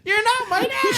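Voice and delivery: high laughing retort. Real, not acted. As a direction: shout it back through laughter.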